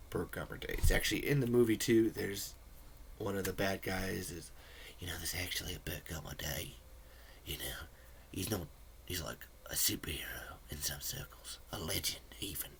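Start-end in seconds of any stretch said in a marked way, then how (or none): 5.0-12.7 put-on lower, quieter villain line in a loose Australian accent. A movie bad guy quoted in a hushed Australian accent. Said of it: "I don't know why that was Australian".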